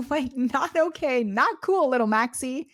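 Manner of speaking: mock scolding. Playfully scolding her new cat.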